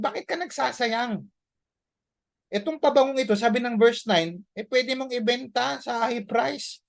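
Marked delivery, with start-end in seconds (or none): 0.0-3.4 higher-pitched, reproachful disciple voice; indignant disciples. Retold or imitated. Disciples rebuking the woman, mildly indignant. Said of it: "they were indignant". 4.6-6.8 indignant disciples. Retold or imitated. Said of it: "they were indignant".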